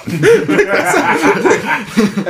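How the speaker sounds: high bursting giggle; laugh. Real, not acted. Laughing at the quoted line.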